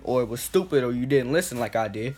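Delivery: ordinary talk, nothing marked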